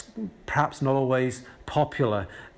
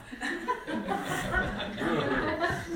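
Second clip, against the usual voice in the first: real laugh; 8 dB quieter than usual. Real, not acted. Preacher laughs at his joke.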